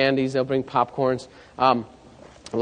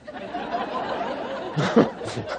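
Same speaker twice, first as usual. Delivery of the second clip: breathy laugh; 8 dB quieter than usual. Real, not acted. Laughs at Don's confession.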